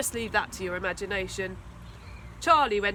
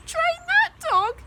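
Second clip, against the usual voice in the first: much higher, slow, louder sneer; sniggering bully; 7 dB louder, slower than usual. A narrator's reading. Charlie mocking the scruffy dog, high slow snigger. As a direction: snigger it out in scorn. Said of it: "he sniggered".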